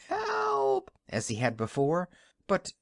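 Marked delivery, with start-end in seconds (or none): 0.1-0.9 very high frantic cries for help; shouting wildly. Acted. Husband runs out of the house shouting wildly for help. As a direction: yell for help at the top of the voice. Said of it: "began yelling".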